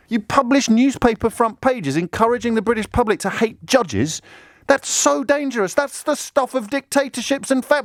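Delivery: high, urgent indignation; acted outrage. Objector condemns front pages attacking judges, acted outrage. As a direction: climb into alarmed protest until cut off.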